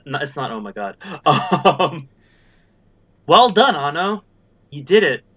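usual speaking voice

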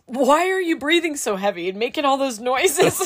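high, loud mock question sliding into laughter; retold question, giggled at high pitch; laughing quote. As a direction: imitate a baffled instructor while cracking up. Retelling instructor's question, cracking up.

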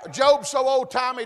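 preaching shout. Real, not acted. Preacher shouting his point to the congregation. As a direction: belt it out to the pews.

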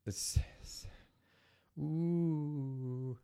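breathy near-silent whisper; almost no voice, wincing; embarrassed whisper; whispered wince. Real, not acted. Embarrassed after failing.

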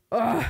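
high, fed-up "ugh"; exasperated groan. Real, not acted. Fed up with annoying rugby guys, exasperated groan. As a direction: let out a real groan of annoyance. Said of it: "They're so annoying".

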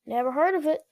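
usual speaking voice

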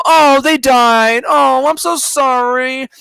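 high, loud, drawn-out mock lament; mock sympathy. Host mimicking people mourning the powerful, mock sympathy. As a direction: gush with exaggerated, insincere sorrow.

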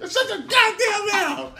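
very high, slow mock yell; hammed-up telling-off, voice way up; retold angry yell. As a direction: comically screech the order, drawn out. Retold row with boss.

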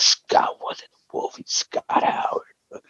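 slow, hushed movie-moment whisper; dramatic whisper. Acted. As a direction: whisper it slowly like the film's big reveal. Dramatic ending of retold movie.

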